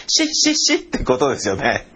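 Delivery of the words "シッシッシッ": shooing sound. Retold or imitated. Cop shooing him away.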